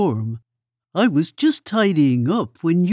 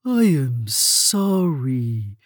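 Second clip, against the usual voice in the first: slow gentle Mole voice; sympathetic Mole; much slower than usual. A narrator's reading.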